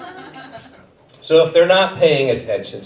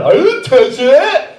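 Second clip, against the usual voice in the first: put-on high, louder child protest; whiny kid denial; 11 semitones higher, 6 dB louder, slower than usual. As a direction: whine like a caught kid. Kid denies to teacher.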